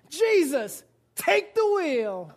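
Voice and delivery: mock earnest plea. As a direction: hand over the wheel dramatically. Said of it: "said to Jesus with our mind".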